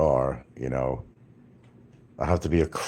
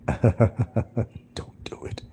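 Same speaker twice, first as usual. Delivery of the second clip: a giggling hushed warning; laughing whisper; 7 semitones higher, much slower than usual. Real, not acted. Warning viewers about beans, whispering through a laugh.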